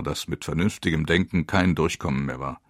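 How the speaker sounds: usual speaking voice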